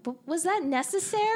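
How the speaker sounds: high mock-incredulous question; incredulous squeak. Reacting to an unneeded scene, a high incredulous squeak. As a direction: squeak it out like you cannot believe it.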